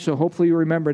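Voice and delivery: usual speaking voice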